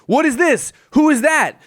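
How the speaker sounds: high-pitched, mounting mock confusion; clueless bewilderment. Mimicking a hero lost in the story, hammed-up bewilderment. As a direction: play a baffled idiot, every discovery a shock.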